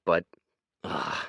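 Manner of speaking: normal talking voice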